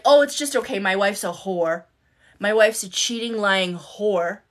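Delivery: loud mock rant of an angry husband; retold yelling. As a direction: imitate him loudly badmouthing his wife to strangers. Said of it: "yelling at me in front of".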